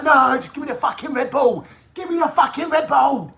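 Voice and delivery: high, rising to a shout; hammed-up addict yelling for his Red Bull; mock desperate demand.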